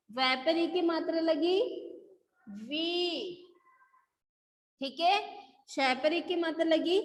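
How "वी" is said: "वी" with the ee held long; long ee vowel. Shown as a demonstration. Teacher modelling the matra for students, long-drawn "वी". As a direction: stretch out the vowel of "वी". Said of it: "लंबा होता है ना".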